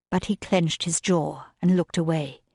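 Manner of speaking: plain reading voice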